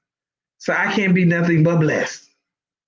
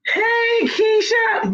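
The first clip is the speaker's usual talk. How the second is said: much higher, calling out to a friend; delighted greeting shout; excited call; 14 semitones higher, much slower than usual. Real, not acted. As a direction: hail her happily across the room.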